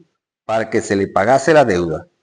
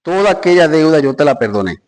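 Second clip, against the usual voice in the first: angry king; 6 semitones higher, 6 dB louder than usual. Acted. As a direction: thunder it out as the betrayed master. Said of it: "su señor enojado".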